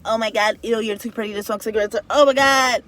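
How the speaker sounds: mocking judgmental viewer. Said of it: "To my viewers that's gonna be like".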